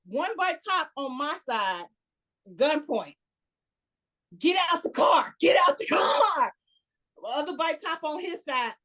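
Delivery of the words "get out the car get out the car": shouted order, higher and louder; imitating a police officer's shout; retold cop command. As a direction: bark it like a cop at gunpoint.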